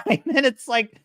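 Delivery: usual speaking voice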